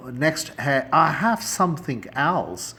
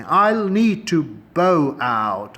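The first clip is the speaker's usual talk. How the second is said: need drawn out and stressed; slow stressed demo; 4 semitones higher, 6 dB louder, much slower than usual. Shown as a demonstration. Teacher modelling a polite refusal slowly. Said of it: "اس طرح لکھتے بھی بولتے ہیں".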